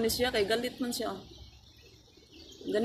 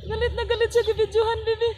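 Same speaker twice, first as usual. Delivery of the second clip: higher and louder, gleeful teasing; excited teasing; 11 semitones higher, 5 dB louder, much faster than usual. Real, not acted. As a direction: tease the angry bird with delight.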